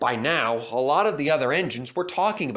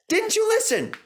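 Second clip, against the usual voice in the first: severe; 4 dB louder than usual. A narrator's reading. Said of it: "Percy went on severely".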